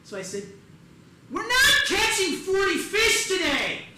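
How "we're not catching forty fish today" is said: high-pitched, slow, very loud yell; exasperated full-volume rant; exasperated shouting. Acted. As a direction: bellow it in comic outrage, stretching the words. Exasperated retort to fishing companion.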